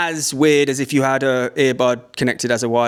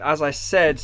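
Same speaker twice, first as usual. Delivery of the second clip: ordinary talk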